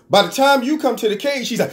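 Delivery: normal talking voice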